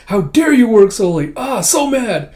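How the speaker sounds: high, loud, hammed-up telling-off; mock scolding. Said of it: "Yor just reprimanding him with".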